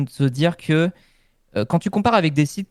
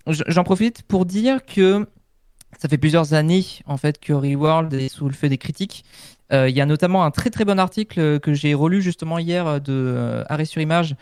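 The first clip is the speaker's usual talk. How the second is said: normal talking voice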